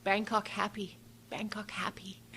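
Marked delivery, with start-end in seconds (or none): 1.3-2.2 breathy whispered echo; soft whispered repeat of the driver. Retold or imitated. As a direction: whisper it under your breath. Repeating the cab driver's words.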